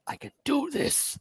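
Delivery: high, hammed-up self pep talk; mock pep talk. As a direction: pump yourself up in a mirror, mockingly. [name] psyching himself in bathroom.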